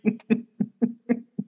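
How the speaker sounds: high giggle without words; soft high-pitched giggle; high laugh. Real, not acted. Laughing at mom's throwing.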